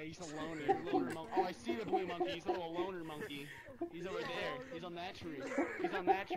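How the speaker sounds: laughing mockery. Real, not acted. Laughing mockery of lonely monkey.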